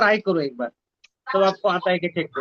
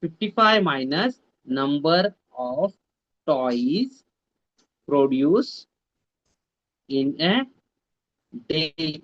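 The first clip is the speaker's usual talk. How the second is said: slow reading; 5 semitones lower, much slower than usual. Shown as a demonstration. Teacher re-reads, word by word.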